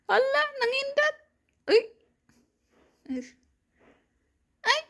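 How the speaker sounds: high, playful teasing coo. Real, not acted. Teasing baby who blinked. (mataas na pabirong boses pambata)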